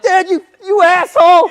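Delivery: high, loud accusing yell; mock outraged yell. Kid yelling at his dad for the prank, high loud yell.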